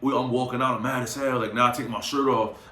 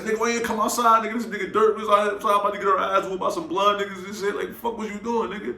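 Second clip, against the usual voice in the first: high angry scolding; 9 semitones higher than usual. Retold or imitated. Confronting friend in hotel room.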